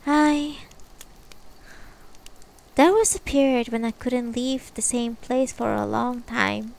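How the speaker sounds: lightly voiced older woman; gentle old woman's voice; character voice. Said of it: "Okay, I'll do the voice".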